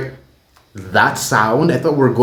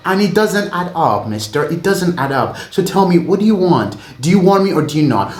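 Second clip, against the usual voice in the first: acting out the singer; 7 semitones higher than usual. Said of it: "basically she's like".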